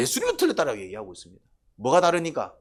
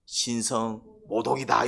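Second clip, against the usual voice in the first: slow mock accusation; 5 semitones lower, slower than usual. 바리새인의 판단 흉내.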